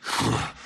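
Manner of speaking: a short scornful laugh; mocking chuckle. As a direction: scoff at the guest. General scoffs at guest.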